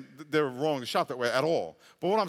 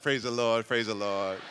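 lower, hammed-up holy tone; fake pious reply; 6 semitones lower than usual. Pastor greeted in line, answering with mock holiness, lower. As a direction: cover impatience with mock piety. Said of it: "I'm like".

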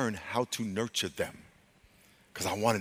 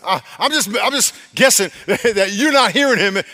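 high, loud, amused protest; laughing accusation; 10 semitones higher, 12 dB louder than usual. Real, not acted. Preacher answering an imagined objector about listening, laughing accusation. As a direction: laugh through a teasing jab. Jokes after confronting congregation.

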